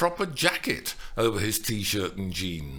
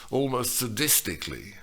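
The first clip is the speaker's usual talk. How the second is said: ordinary delivery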